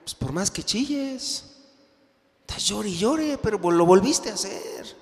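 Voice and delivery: high, scornful reproach; wife rebuking her crying husband, high scolding. Acted. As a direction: tell him his tears mean nothing. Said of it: "la mujer diría".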